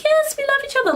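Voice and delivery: very high, fluffy mock-romantic voice; mock lovey-dovey. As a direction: squeak it like gushing lovers, sending them up. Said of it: "fluffy ... being all like".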